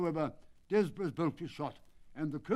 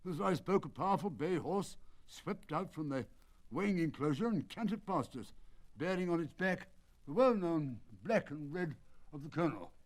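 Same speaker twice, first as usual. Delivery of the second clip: ordinary delivery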